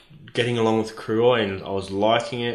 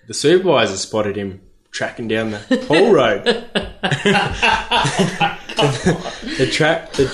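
laughing; 4 semitones higher, 4 dB louder than usual. Real, not acted. Laughing while telling.

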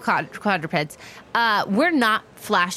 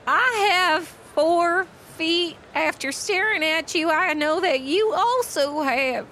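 high, slow, hesitant pony voice; awkward Tangerine; 6 semitones higher, slower than usual. Acted. Tangerine makes small talk with Omen, awkward and halting. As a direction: feel out each phrase shyly with long pauses.

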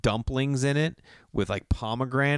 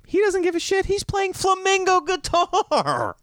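much higher, louder, slowed outrage; exasperated; 4 dB louder than usual. Retold or imitated. Comic griping about guitar-playing rival, high whiny outrage. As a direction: whine about the rival's calm.

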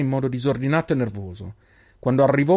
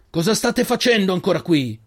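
higher, louder angry shout; 8 semitones higher, 4 dB louder than usual. A narrator's reading. The old man shouts at the boys for not leaving. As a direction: yell at them for still being here. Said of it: "urlò il vecchio".

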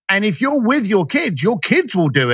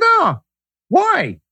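much higher, smug little oh, why; smug, fishing; 9 semitones higher than usual. Retold or imitated. Telling a museum director he is smooth, smug and much higher. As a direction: swell with pride and fish for a compliment. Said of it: "I'm feeling all full of myself".

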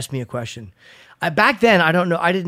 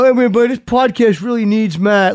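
a hint of complaining listeners, higher and louder; mock complaint; 6 semitones higher, 6 dB louder than usual. Host voicing listeners who miss the co-host, mock complaint. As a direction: imitate griping fans, pushed up in pitch.

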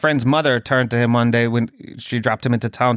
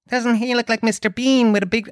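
very high imitated kid voice; boy's friend; 10 semitones higher than usual. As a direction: mimic a child's taunt, pitched right up. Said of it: "then his friend comes over".

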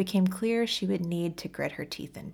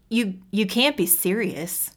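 slow, louder, stammered disbelief; stunned exclamation; 3 semitones higher, 5 dB louder, slower than usual. Acted. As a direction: say it as if you can't believe it. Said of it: "he exclaimed".

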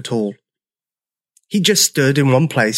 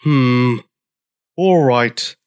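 grudging, gruff agreement; grunting; much slower than usual. A narrator's reading. Old Triceratops giving in to newcomer, grunting. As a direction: grunt it reluctantly. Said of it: "grunted George".

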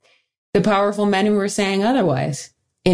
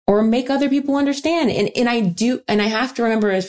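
ordinary talk, nothing marked

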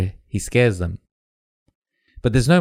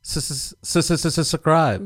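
stuttering s-s-s then subscribe; mock stutter; 6 semitones higher, 3 dB quieter than usual. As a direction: playfully stammer into subscribe. Mocks the stumble on subscribe.

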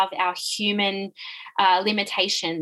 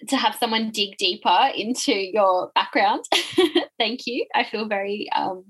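ordinary talk, nothing marked

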